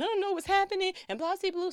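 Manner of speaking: high, loud, distraught mother; panicked and tearful, not knowing; retold crying. Mother crying after the call about her son, high and loud. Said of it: "she's crying at this point".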